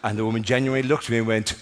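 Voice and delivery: usual speaking voice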